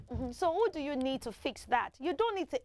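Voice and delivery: ordinary talk, nothing marked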